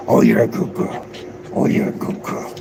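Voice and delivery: soft whisper to the dog; whispered praise. Real, not acted. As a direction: hush and praise her gently.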